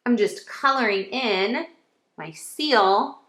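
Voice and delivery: ordinary talk, nothing marked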